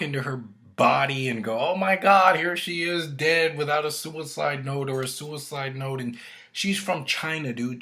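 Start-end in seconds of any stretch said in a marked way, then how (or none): 1.6-6.0 high, loud hammed-up gasp; hammed-up discovery; mock shock. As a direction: play fake horror at the body. Acting detective finding the body.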